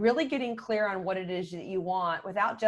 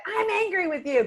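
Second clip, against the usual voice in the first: raised, louder scolding voice; mock angry outburst; 10 semitones higher, 3 dB louder than usual. Parent confronting teenage girls, mock angry outburst. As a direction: blurt it high and loud, hammed-up anger.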